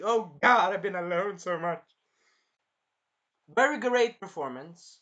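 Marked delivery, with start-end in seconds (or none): 0.0-1.8 loud, higher 'oh God' outburst, then easing; mock exclamation. Retelling a lonely movie character's outburst, mock exclamation. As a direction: act out an overwhelmed cry, hammed up.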